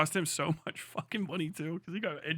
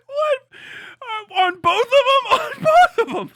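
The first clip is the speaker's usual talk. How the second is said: much higher and louder, laughing; shocked disbelief; 16 dB louder than usual. Real, not acted. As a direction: squeal in utter disbelief. Disbelief at hand tattoos.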